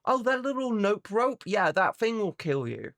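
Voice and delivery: a hint of a breezy Australian local; mock Aussie local. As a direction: cheerfully warn of deadly things. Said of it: "every creature there wants to kill you".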